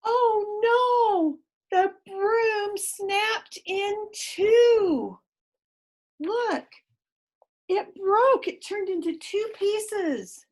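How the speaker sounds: high, alarmed oh no. Real, not acted. Reader alarmed to the child as the broom snaps. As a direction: gasp it out in surprise.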